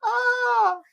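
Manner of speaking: very high drawn-out "ah" from laughter; laughing exclamation. Real, not acted. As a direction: squeal out an amused "ah". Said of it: "I'm laughing now".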